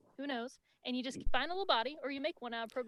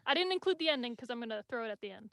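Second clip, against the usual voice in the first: normal talking voice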